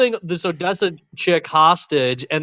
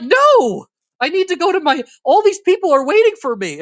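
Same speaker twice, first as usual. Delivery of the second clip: high-pitched mock-frantic protest; exasperated refusal; 13 semitones higher, 4 dB louder than usual. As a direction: refuse in a high voice, imitating her alarm. Acts actress's exasperated refusal.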